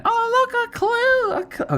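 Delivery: very high, squeaky put-on voice; pitched far up like a little cartoon helper; kids' show helper. Kids' show character points out a clue, high mock squeal.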